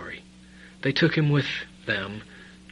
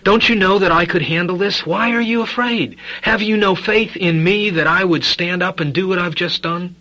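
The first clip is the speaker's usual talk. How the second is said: Jesus' rebuke; 6 semitones higher, 6 dB louder than usual. Retold or imitated. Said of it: "Jesus was saying".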